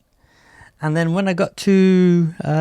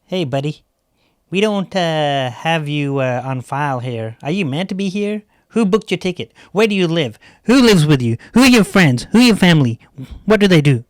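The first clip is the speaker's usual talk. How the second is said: put-on an American border officer's accent, building to a loud barrage of questions; American accent, firing questions harder and louder; mock American officer's accent, getting louder; attempted American accent; 3 semitones higher, 6 dB louder than usual. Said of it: "I can't do an American accent".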